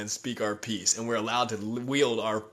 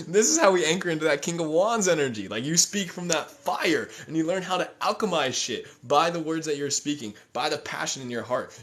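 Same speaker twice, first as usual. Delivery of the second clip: higher, louder impassioned sermon; fiery preaching; 6 semitones higher, 5 dB louder than usual. Real, not acted. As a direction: speak from the fire. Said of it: "that was some Sagittarius fire right there".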